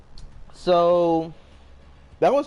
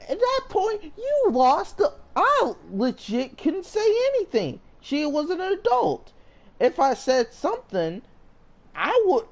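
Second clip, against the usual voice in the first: voice breaking; 11 semitones higher than usual. Real, not acted. Upset retelling, voice breaking.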